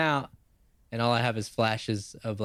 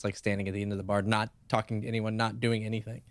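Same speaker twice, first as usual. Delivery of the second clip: usual speaking voice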